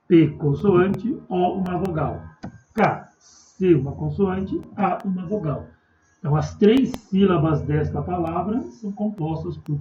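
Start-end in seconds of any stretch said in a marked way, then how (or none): none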